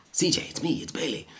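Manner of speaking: high, soft pleading dog voice, fading to a whisper; a dog's inner voice calling out to C J; high plea dropping to a whisper; voicing the dog Bailey. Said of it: "but he can't talk, he's a dog".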